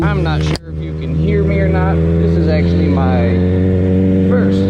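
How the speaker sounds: muffled helmet mic over engine; talking inside a motorcycle helmet. Real, not acted. Moto vlog recorded inside helmet.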